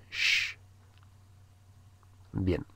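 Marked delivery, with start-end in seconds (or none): 0.1-0.6 sh as a quiet hiss; a soft shh of breath; sh sound. Shown as a demonstration. Teacher repeats the sh sound quietly for learners. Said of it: "ese sonido".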